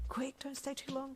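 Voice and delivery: high, hushed urgent plea; urgent whisper. Acted. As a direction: hurry yourself under your breath. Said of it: "you mentally screamed".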